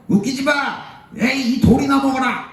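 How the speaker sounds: high, acted angry outburst; resentful mob. Israelites turning on Joshua and Caleb with stones, high angry jeering. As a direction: scoff and attack in a high voice. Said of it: "백성들은 이들을 원망하면서".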